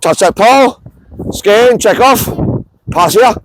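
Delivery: drill calls. Real, not acted. Coach calls cues while the players run the drill. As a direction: bark each cue to the players.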